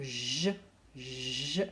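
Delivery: "je" as a long "jjje" sound; "je" given alone, its j held; isolated letter sound. Shown as a demonstration. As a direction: draw out the consonant of "je". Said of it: "en tout premier, on entend".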